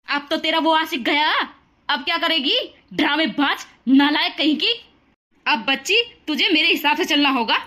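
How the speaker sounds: mother-in-law taunting the bride once her son is gone; harsh mother-in-law. Acted. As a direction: sneer and boss her around.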